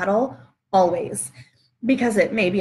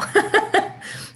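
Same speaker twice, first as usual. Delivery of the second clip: embarrassed laugh; 11 semitones higher than usual. Real, not acted. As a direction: laugh it off sheepishly. After sneezing on live video.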